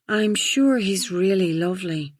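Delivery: put-on slow, plummy upper-class accent; drawn-out posh English accent. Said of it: "she had put on a posh voice".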